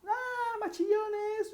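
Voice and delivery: very high, squealing demo voice; shrill high tone. Shown as a demonstration. Teacher illustrating high tones, in a shrill squeal. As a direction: jump way up into a shrill pitch. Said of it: "Hay tonos que son más agudos".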